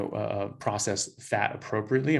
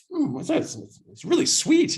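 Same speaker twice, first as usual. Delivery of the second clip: very high, squeaky mock Ramsay; Gordon Ramsay tasting; 5 dB louder than usual. Ramsay tasting breast milk on camera, very high mock squeal. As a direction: squeal it out, surprised by the taste. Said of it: "He's like".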